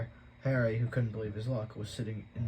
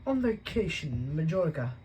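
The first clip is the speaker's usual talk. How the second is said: higher, curt snap; snapped; 6 semitones higher, 4 dB louder than usual. Acted. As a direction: bite the words off. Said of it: "snapped Aunt Petunia".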